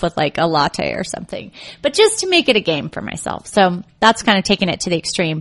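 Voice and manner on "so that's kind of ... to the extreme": normal talking voice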